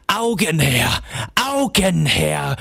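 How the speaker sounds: a menacing sandman calling for eyes, held out; booming hollow voice. A narrator's reading. Coppelius demands eyes over the fire, a looming call. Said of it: "rief Coppelius mit dumpfer, dröhnender Stimme".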